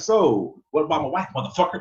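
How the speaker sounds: high, loud mock threat; hammed-up jealous husband; angry husband. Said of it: "He'll be like".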